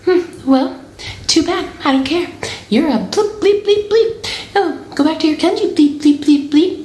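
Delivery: high-pitched snotty mimicry; mocking imitation. Mother mimics the girl's insults with bleeps for swearing, mocking imitation. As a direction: mimic a rude girl, way up high. Mocks the girl's rude retort.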